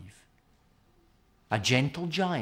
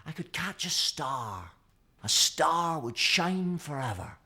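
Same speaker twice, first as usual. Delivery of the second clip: longing for a star; dreamy; 6 semitones higher, 3 dB louder than usual. A narrator's reading. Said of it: "said Mickey".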